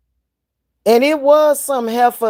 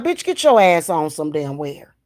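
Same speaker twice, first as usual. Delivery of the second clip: mock telling off; 2 semitones lower than usual. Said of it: "I'm be like".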